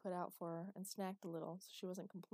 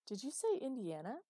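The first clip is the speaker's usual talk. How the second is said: high, loud rising question; disbelieving; 6 semitones higher, 6 dB louder than usual. A narrator's reading. Father hears where his daughter is, incredulous. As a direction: check it in startled disbelief. Father cannot believe what he heard.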